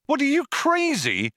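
high-pitched disbelief; incredulous. A narrator's reading. Zaphod rejecting Arthur's risky idea, incredulous. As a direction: shoot up high, scandalised.

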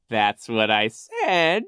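high, slow, loud villain voice; scheming Coach Genghis. A narrator's reading. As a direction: feign puzzlement with drawn-out villainy. Narrator plays Coach Genghis.

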